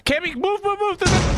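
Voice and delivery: high, urgent panicked yelling; frantic warning to run; panicked shouting. Real, not acted. Urgent warning, spider chasing.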